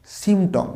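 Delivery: "সিম্পটম" with silent p, sim-tom; everyday sim-tom; common mispronunciation. Shown as a demonstration. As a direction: say "সিম্পটম" dropping the p. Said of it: "আমরা অনেকের উচ্চারণ করে".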